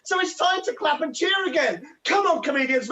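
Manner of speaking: ordinary talk, nothing marked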